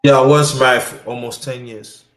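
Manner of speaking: usual speaking voice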